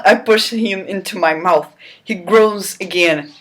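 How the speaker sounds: normal talking voice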